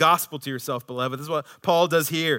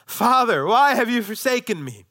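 high, raised cry in a sermon; preached yell; 9 semitones higher, 7 dB louder than usual. Retold or imitated. Preacher voicing Jesus on the cross, preached yell. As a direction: call out loudly, imitating an anguished plea.

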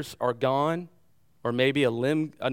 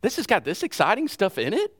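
excited thought; 7 semitones higher, 4 dB louder than usual. Retold or imitated. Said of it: "I thought".

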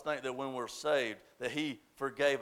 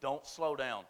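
ordinary delivery

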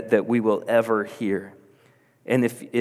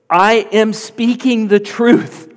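high, loud, slow proclamation; voicing Jesus insisting, word by word; emphatic declaration; 9 semitones higher, 6 dB louder, slower than usual. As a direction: declare it slowly with full force. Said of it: "where he says it like this".

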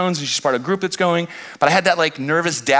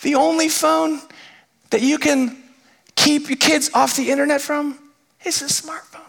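mock-matter-of-fact clerk; 10 semitones higher than usual. Clerk explaining to a frustrated dad. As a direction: say it as if it's obvious. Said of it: "She says".

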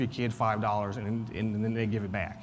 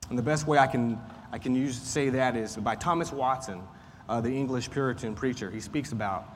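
normal talking voice

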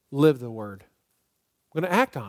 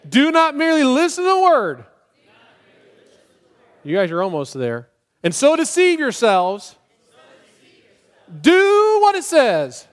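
much higher, louder, slow shouted verse; car-stereo shout; 9 semitones higher, 7 dB louder than usual. Shown as a demonstration. Pastor shouting the memory verse like driving in his car. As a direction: belt it like singing alone in your car. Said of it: "Do it like I'm driving down the road in my car".

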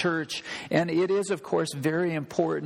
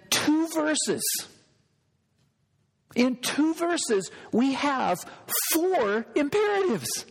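animated emphasis; 9 semitones higher than usual. Real, not acted. Emphasizing four imperatives.

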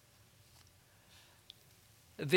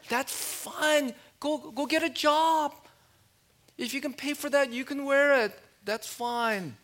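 much higher, mock-sweet then louder mom voice; sarcastic mom; 9 semitones higher, 5 dB louder than usual. Preacher playing a mom answering her entitled child, sarcastic and high. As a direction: mom cheerfully laying down the law. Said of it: "Moms, you can just say".

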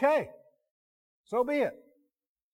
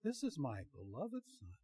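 very soft, gentle imitation of a woman's voice; hushed mock-feminine reading; 4 semitones lower, 14 dB quieter than usual. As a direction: say it softly, as a feminine narrator would. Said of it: "had it in a feminine voice".

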